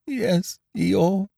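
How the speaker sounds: very high, small, tearful voice; sniffing little Piglet. A narrator's reading. Piglet admitting it to Eeyore, sniffing a little. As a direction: squeak it meekly, a touch tearful. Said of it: "sniffing a little".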